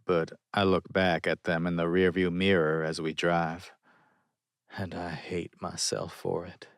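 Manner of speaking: plain reading voice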